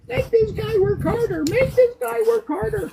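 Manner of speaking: very high squeaky machine voice; talking machine. Acted. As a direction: pipe it up high as a nagging machine. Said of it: "the machine is gonna say".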